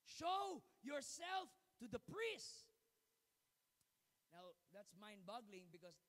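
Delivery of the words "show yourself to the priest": slow, loud, raised command. Acted. Jesus commanding the healed lepers, slow loud proclamation. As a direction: proclaim the order slowly and loud. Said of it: "Jesus said".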